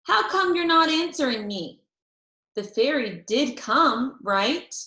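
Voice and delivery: put-on boy worried the fairy forgot his friend; Herb anxious. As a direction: push for an answer. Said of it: "he said".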